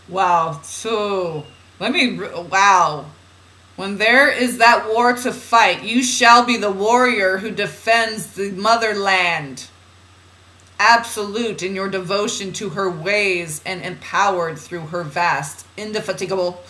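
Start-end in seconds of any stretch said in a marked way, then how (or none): 3.8-15.7 louder, rallying oracle reading; declamatory reading. Acted. Reads channeled guidance aloud. 15.8-16.6 INDEFATIGABLE run off quickly in one go; fast word. Shown as a demonstration. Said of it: "saying that word fast like that".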